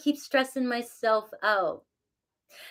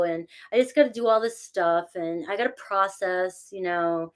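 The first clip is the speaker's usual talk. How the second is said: ordinary talk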